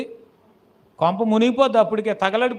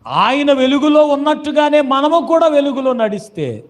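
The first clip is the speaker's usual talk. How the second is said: loud, raised preacher's voice; fervent preaching; 6 semitones higher, 7 dB louder than usual. Acted. Preacher urging believers to walk in the light, fervent. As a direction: proclaim it forcefully to the congregation.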